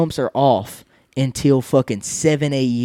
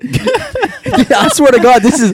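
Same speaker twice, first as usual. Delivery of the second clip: laughing protest; 10 semitones higher, 4 dB louder than usual. Real, not acted. Insisting to friends it was the first stroke, laughing. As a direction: swear it through the laughter.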